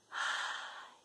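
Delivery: very soft, voiceless sighed "ay"; resigned sigh. Acted. Woman in the caravan before told-you-so, sighing. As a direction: let out a long, quiet breath.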